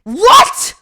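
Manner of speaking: shrill shocked scream; teacher screaming what. Retold or imitated. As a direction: scream it in disbelief. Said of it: "everyone has never heard her really scream".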